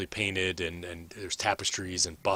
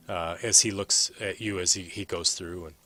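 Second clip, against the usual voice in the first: ordinary talk, nothing marked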